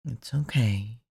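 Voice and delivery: usual speaking voice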